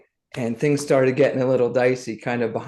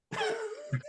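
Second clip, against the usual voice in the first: sudden high burst of laughter; rueful laugh at own blind spot; 13 dB quieter than usual. Real, not acted.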